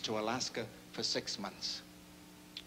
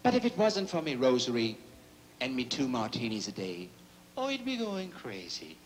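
Irish priest; 4 dB louder than usual. Acted. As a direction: tell the truth in a brogue. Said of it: "He says".

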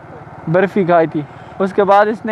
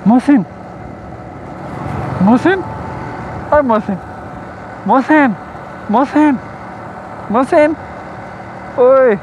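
calling his brother's name again and again, each call higher; 5 semitones higher than usual. Real, not acted. Calling out to his little brother, rising higher with each call. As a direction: keep calling Mohsin, pitch climbing every time.

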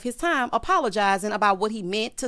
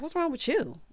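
higher, softer mock attitude; full-on attitude; 5 semitones higher, 5 dB quieter than usual. Retelling him confronting someone, mock attitude. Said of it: "got a full-on attitude".

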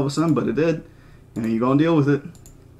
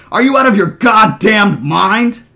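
high, loud, drawn-out yell; enraged shout over helicopter noise; furious scream; 5 semitones higher, 6 dB louder, slower than usual. Acted. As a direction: scream it, leaning on every word. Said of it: "Gennaro screamed".

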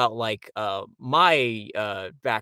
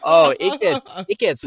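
laughing out the words, higher and louder; 6 semitones higher, 9 dB louder, slower than usual. Real, not acted. Guest laughing while telling the host his story. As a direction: break into a laugh mid-line.

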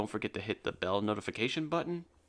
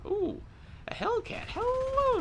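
high mock greeting; slower than usual. Excited high greeting to enemy tank.

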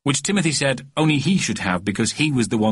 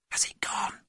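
very soft, voiceless question; nervous boy's hushed whisper; 10 dB quieter than usual. A narrator's reading. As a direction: whisper it from hiding. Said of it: "whispered Timothy".

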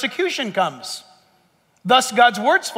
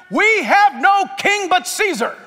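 slow, solemn proclamation from the crowd; chief priests' declaration; 8 semitones higher, 3 dB louder than usual. Acted. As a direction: draw it out like the priests rejecting their king. Chief priests answering Pilate.

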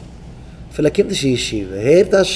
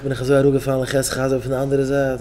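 ordinary delivery

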